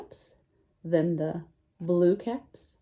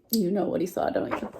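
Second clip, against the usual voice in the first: usual speaking voice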